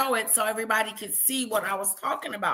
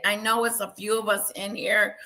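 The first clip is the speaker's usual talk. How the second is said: ordinary delivery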